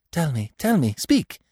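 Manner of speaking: high, urgent pleading; acted desperate entreaty; imploring. A narrator's reading. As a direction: beg to be told, pitch leaping up. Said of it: "Climene implored him".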